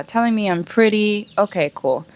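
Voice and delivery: usual speaking voice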